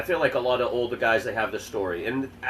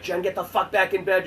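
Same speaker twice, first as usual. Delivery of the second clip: higher-pitched mock telling-off; mock parental scolding; 7 semitones higher than usual. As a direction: play an exasperated parent sending a kid to bed. Mimics parents ordering Jen.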